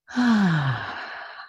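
long audible exhale; settling sigh. Real, not acted. Teacher settling students into guided meditation, long releasing sigh. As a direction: let out a long, falling sigh.

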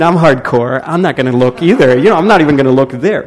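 mock tough resolve. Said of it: "And I'm thinking".

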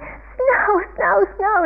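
very high, frustrated cries; Calvin. Retold or imitated. Calvin running around wanting snow, frustrated yelling. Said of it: "He's getting really frustrated".

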